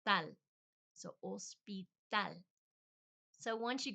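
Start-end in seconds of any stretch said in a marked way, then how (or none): 0.1-0.2 Tal with crisp T, open A, tongue-up L; Spanish final syllable. Shown as a demonstration. Demonstrating tongue position, Tal with open A and light L. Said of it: "the tongue goes up too". 1.2-2.4 hospital as os-pee-TAL, syllables parted; slow down hospital, silent H, last syllable stressed; slow Spanish hospital. Shown as a demonstration. Recapping for learners, hospital as slow os-pee-TAL. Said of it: "but in Spanish".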